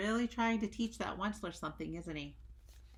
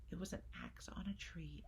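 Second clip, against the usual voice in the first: softer, near whisper; hushed dramatic narration; 9 dB quieter than usual. A narrator's reading. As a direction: breathe it out in awe. Hushed moment in story.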